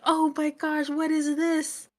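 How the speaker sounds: hammed-up surprise; mock shock. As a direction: freak out lightly. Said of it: "she's like".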